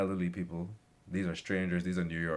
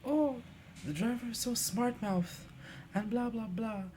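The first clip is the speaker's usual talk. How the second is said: high, snooty mock of the ladies; mocking grumbling lady. Ladies grumbling off the bus, high snooty mockery. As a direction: sneer in a put-on lady voice. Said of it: "I was like".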